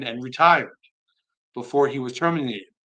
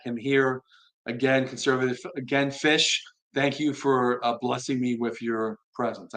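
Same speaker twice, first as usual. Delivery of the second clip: ordinary talk, nothing marked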